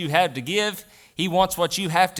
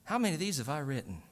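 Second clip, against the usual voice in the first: musing to himself; 2 semitones lower, 6 dB quieter than usual. Retold or imitated. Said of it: "I'll look at that check and I think".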